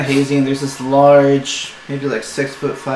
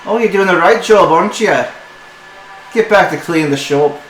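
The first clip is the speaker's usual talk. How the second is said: loud, higher mocking reprimand; sarcastic scolding; 4 semitones higher, 4 dB louder than usual. Mistress sarcastically ordering her apprentice back to work, loud. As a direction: send him off with scorn.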